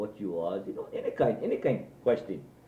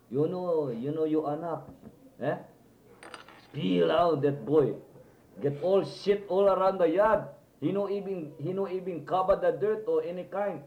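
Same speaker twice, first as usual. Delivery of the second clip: higher-pitched mock scolding, drawn out in places; retold complaint; 7 semitones higher than usual. Old man complaining to Manang about the boy, retold scolding. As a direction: imitate an indignant telling-off.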